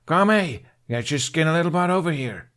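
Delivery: loud, high call of a big mother. A narrator's reading. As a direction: call her daughter over from afar. Mother calling daughter.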